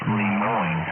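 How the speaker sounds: Lemoyne said lee-MOYN, falling and firm; correct pronunciation. Shown as a demonstration. Pennsylvania ham corrects the town name, Lemoyne as lee-MOYN. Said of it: "Yeah, pronunciation".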